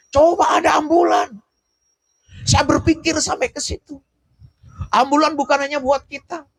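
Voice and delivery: high-pitched, urgent sermon appeal; impassioned preaching. Real, not acted. Preaches passionately about ambulance vision.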